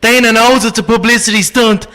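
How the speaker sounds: cocky Irish brogue, trash talk; Irish accent, swaggering fighter's boast; Conor McGregor impression. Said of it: "how long you been doing impressions".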